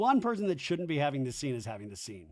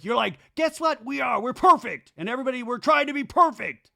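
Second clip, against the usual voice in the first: louder, fast mocking rant; 9 semitones higher, 7 dB louder than usual. Jax yelling at Danny during the fight, loud fast mock rant. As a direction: hammer it out louder and faster, mocking. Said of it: "Jax is like".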